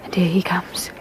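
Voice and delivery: tense hushed warning; whisper. Acted. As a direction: whisper it as he approaches. Stranger approaching them.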